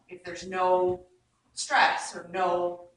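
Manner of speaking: ordinary delivery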